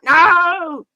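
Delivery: a high, loud cry of frustration; frustrated shout. Retold or imitated. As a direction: yell at the tape that failed to load. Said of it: "And it's like".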